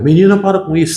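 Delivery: bronca imitada, voz mais aguda; bronca de mãe. Retold or imitated. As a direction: repreende a menina com impaciência. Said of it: "Sempre reclamavam dessa maneira". (mãe reclamando da filha, bronca imitada e aguda)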